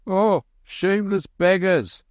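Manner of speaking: high, angry farmer's yell; an angry farmer shouting at beggars. A narrator's reading. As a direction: drive the beggars off. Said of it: "shouted the farmer".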